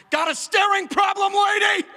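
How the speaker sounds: high, loud snap at a stranger; snapping shout. Retold or imitated. Small child snapping at a staring woman, high loud shout. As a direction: bark it at her like an angry toddler. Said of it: "snapped, and went".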